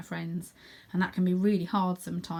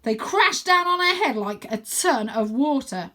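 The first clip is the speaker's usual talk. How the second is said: loud, slow dramatic exclaim; excited cry; 5 semitones higher, 6 dB louder, slower than usual. Acted. Wyn crying out about the crashing waves, dramatic. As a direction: sell how huge the waves were. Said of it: "cries Wyn".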